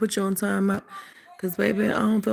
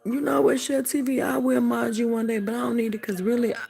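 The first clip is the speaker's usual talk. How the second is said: usual speaking voice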